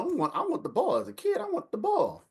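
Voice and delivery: put-on high, whining child voice; whiny little kid. Little boy whining to his mother for the ball, high whine.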